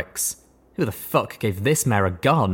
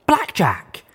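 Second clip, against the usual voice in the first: surprised recognition; 11 semitones higher, 3 dB louder than usual. A narrator's reading. Recognition, eyes popped wide.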